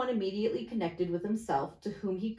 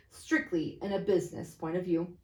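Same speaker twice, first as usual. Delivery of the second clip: plain reading voice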